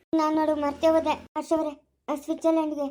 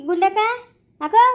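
loud hail, rising at the end; calling out; 4 semitones higher, 8 dB louder than usual. Acted. Hailing elder sister to pass on news, calling out. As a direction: shout for her attention.

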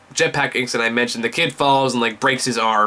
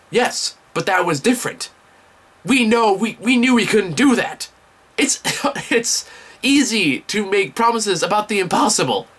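higher-pitched, emphatic dad defending himself; pitched-up imitation of a defensive father; mock exasperated dad; 7 semitones higher than usual. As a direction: act out a flustered parent's protest. Dad's retort in retold film scene.

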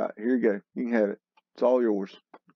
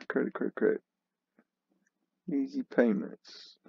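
breathy under-the-breath repeat, softer; whispered muttering; 7 dB quieter than usual. Real, not acted. Reading old shop signs to himself, whispered. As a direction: mouth each word to yourself, almost silent.

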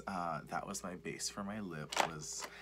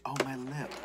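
sudden exclamation; 7 semitones higher, 8 dB louder than usual. Real, not acted.

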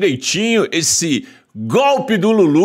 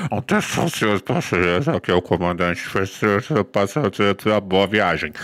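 over the intercom; 11 semitones lower, 4 dB quieter than usual. Retold or imitated. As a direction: lower muffled captain announcement. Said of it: "é que nem os alto-falantes do avião".

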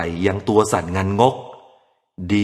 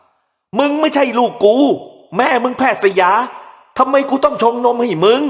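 put-on red-faced drunken snarl; 4 dB louder than usual. A narrator's reading. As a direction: bark it at the baby. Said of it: "ตะคอกหน้าแดง".

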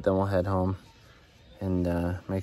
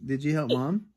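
ordinary talk

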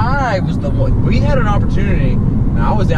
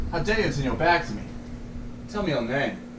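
movie line cracking into laughter, quiet; can't hold the straight face, laugh through it; breaking up laughing; 2 semitones lower, 10 dB quieter than usual. Pretend. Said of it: "My name is Maximus Decimus".

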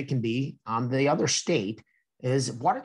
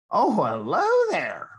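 chirpy Drop greeting; 7 semitones higher than usual. Acted.

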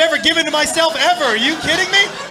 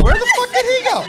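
high, loud, laughing disbelief; watching his friend vanish, shouting in disbelief; mock astonishment; 12 semitones higher, 4 dB louder than usual. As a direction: gasp out where he went. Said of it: "I was like".

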